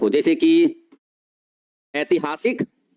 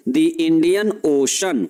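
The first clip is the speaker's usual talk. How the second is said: slow teaching repeat; 2 semitones lower, much slower than usual. Shown as a demonstration. Teacher to students, repeating the answer very slowly. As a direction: stretch out "The Indian Ocean" very slowly. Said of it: "क्या बोलेंगे?".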